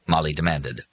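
plain reading voice

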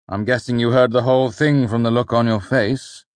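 droge, licht geamuseerde vader; wry. A narrator's reading. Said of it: "he said with a wry grin".

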